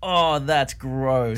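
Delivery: long, drawn-out disgust. Real, not acted. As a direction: drag out each word in revulsion. Disgusted by bacterial slime.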